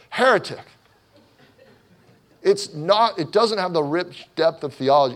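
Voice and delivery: high, loud cry of heresy; mock accusation. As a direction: imitate a scandalized critic, loud and high. Acts out outraged worship critics.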